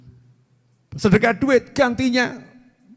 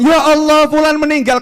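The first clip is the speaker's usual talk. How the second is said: higher, louder cry of shock; shocked exclamation; 8 semitones higher, 7 dB louder than usual. Retold or imitated. People hearing someone died, crying out in shock. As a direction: cry out at sad news.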